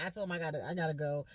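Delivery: ordinary delivery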